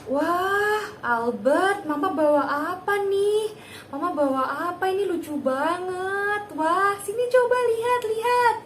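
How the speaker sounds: put-on high, bright cheerful call to the child; playful, overjoyed mom voice; high excited voice. Shown as a demonstration. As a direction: sound thrilled to win his attention. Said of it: "kita pakai intonasi yang tinggi, yang menyenangkan".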